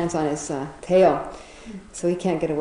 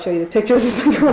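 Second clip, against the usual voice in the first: quick, louder, giggling through the words; laughing while talking; 4 semitones higher, 7 dB louder, faster than usual. Real, not acted. Storyteller offering to show the pictures, laughing. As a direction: break into a laugh as you talk.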